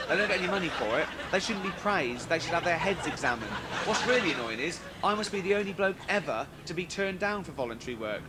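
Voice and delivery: ordinary talk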